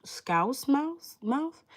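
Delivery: high, questioning lift on each word of "Scouse Mouse? Mouth?"; unsure sounding-out. Real, not acted. Streamer puzzling over a viewer's username, unsure. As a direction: try "Scouse Mouse? Mouth?" as rising guesses.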